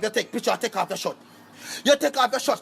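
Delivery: usual speaking voice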